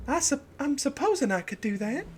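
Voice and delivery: high, breathy Southern belle voice; southern belle accent. Acted. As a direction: play a coy Southern belle dealer. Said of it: "give her a Southern belle accent".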